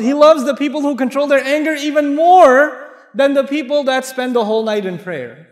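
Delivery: impassioned preaching. Acted. Preacher urging the congregation that controlling anger is loved most, impassioned. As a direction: drive the point home to the crowd.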